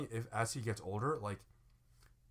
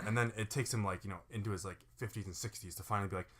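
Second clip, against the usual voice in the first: ordinary talk, nothing marked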